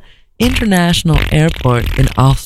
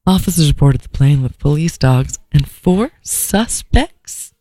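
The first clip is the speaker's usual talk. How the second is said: ordinary delivery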